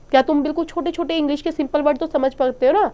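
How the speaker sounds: ordinary talk, nothing marked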